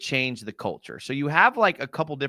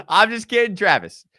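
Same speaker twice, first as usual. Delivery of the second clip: laughing apology; 6 semitones higher, 7 dB louder than usual. Real, not acted. Teasing a player after joke.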